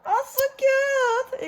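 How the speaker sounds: very high, long-drawn squeal; gushing squeal. Real, not acted. Friend opening a cute gift, drawn-out gushing squeal. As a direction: stretch it out high and fuller-voiced.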